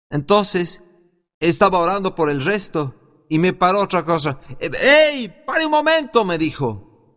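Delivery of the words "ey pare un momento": high, raised retold interruption; calling out. Man stopping the preacher as he prays for others, calling out. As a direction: imitate someone calling out to halt him.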